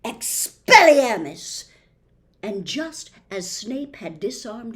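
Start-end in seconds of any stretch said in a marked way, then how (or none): 0.0-1.6 loud, higher, slow spell cry; loud shouted incantation. A narrator's reading. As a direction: fire the spell at full voice.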